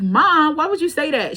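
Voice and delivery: high, loud mock protest; reenacted outrage at mom; embarrassed protest. Daughter scolding her mom, high embarrassed protest.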